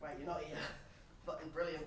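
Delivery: muttered low and soft, to himself; low muttered grumble. Real, not acted. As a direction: grumble it under your breath, deflated. Talking to absent mate, annoyed.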